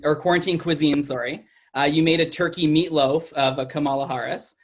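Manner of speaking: ordinary talk, nothing marked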